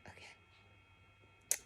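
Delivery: barely voiced, faint whisper; tiny whisper. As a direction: breathe it out in a hush. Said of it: "I was like".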